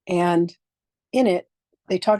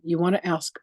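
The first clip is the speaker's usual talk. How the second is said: ordinary delivery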